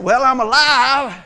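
loud, drawn-out mock reply; mock half-hearted "alive". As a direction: drag it out, imitating a joyless believer. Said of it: "Is that what alive looks like".

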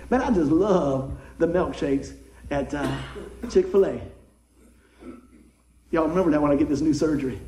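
ordinary delivery

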